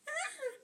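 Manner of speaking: short high squeal of fright; high scream. Acted. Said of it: "they screamed again".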